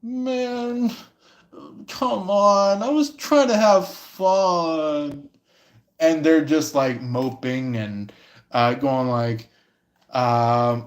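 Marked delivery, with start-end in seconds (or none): 0.0-5.3 higher, slow whining complaint; mopey whine.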